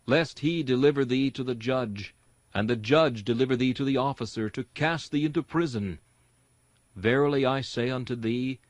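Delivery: ordinary talk, nothing marked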